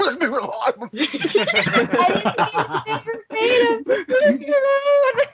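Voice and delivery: high, loud, helpless laugh-crying through the words; giggling so hard it nearly turns to tears; laughing embarrassed. Real, not acted. Stell caught giggling in the background, laugh-crying and high. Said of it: "You're laughing".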